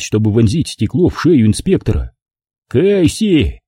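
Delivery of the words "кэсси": высокий оклик, лишь чуть громче обычного; окликни её резко повышенным тоном; окрик. A narrator's reading. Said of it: "крикнул Холман". (сдержанный высокий крик)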